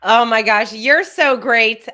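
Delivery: loud, slowed, delighted gush; gushing praise. Real, not acted. Host gushes loudly at her guest's dream. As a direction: pour out delighted admiration.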